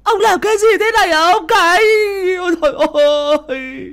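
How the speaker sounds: very high, loud shriek; wife shrieking at her husband; wife screaming. A narrator's reading. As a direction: shriek at him in horror. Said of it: "bà ấy gào lên".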